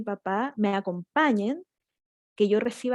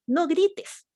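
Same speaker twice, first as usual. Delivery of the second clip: grito de adulto; 7 semitones higher than usual. Retold or imitated. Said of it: "gritando o descontrolado".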